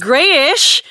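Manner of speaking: "Greyish" asked high and loud, teasing rise; playful question. Real, not acted. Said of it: "that's my word".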